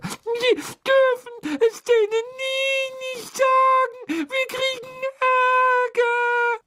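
shrill tearful elf pleading, slow; cartoon crybaby. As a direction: beg through sobs, high and loud. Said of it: "schluchzte die Hauselfe".

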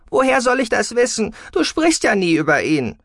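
loud, high, exasperated outburst; angry retort. A narrator's reading. Daughter cuts in, quarrelling.

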